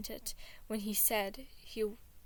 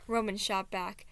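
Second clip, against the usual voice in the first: ordinary delivery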